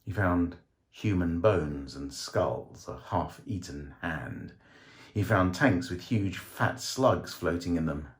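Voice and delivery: ordinary delivery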